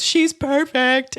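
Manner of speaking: high, slow, loud cry of joy; retold tearful shout. Wife shouting to husband about the kitten, loud and slow. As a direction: she blurts it out overcome, slowly and loudly. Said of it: "I just shouted".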